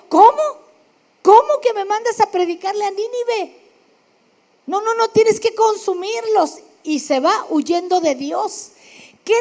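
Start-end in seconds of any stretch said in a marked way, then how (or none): 0.1-6.6 high, loud, outraged protest; indignant outburst. Acted. Retelling Jonah refusing God, raised outraged voice. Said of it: "Tiene una crisis de fe y dijo".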